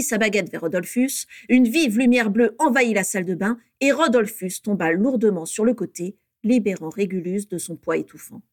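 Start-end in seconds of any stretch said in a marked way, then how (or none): none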